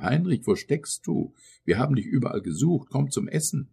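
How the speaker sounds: high, rising call. A narrator's reading. Old mother calls her son to dinner, calling out. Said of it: "rief eine alte Frau".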